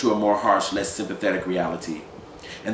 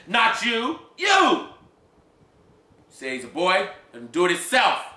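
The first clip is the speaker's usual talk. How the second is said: angry shout; 9 semitones higher, 3 dB louder than usual. Acted. Man shouts at wife then child.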